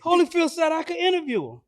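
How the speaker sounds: high, loud mock insistence; imitated protest. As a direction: argue back high-pitched as the stubborn son. Voices son arguing back.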